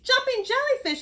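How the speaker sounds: high, loud cry. Acted. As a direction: yelp it after bumping the pearl. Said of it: "he cried".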